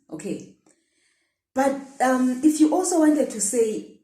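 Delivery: normal talking voice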